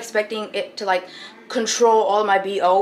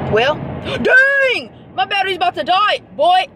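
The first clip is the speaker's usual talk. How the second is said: higher, gasping alarm; startled dang; 8 semitones higher than usual. Real, not acted. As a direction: caught off guard by the battery. Gasp at the dying battery.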